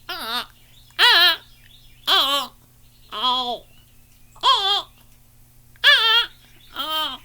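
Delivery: high, spaced single "ah" caws, loud; slow separate nasal-free caws like a fish crow; fish crow call. Shown as a demonstration. Bird caller demonstrates the fish crow, high spaced caws. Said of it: "and the fish crow goes".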